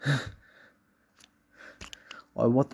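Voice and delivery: ordinary delivery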